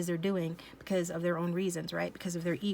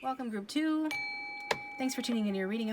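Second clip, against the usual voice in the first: bright welcome; 4 semitones higher than usual. Real, not acted. Upbeat intro to next group.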